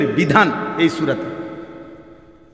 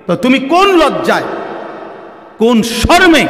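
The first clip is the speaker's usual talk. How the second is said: fiery rebuke; 6 semitones higher than usual. Real, not acted. Preacher scolding the youth for mocking Islam, fiery. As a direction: thunder at them in righteous anger.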